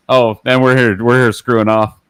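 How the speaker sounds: usual speaking voice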